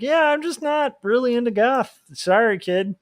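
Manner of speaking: high, airy, dismissive; breezy Dracula. Retold or imitated. Dracula turns down eager goth kid, high and breezy. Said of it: "the guy's like".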